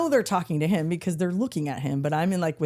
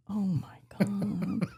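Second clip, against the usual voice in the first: embarrassed dad; 3 semitones higher, 6 dB quieter, much slower than usual. Retold or imitated. Dad embarrassed, slow drawn.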